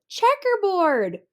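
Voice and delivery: high, slow drawn-out shout. Acted. A classmate shouting a pattern at Camilla. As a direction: call it out to the class. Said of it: "Someone else shouted".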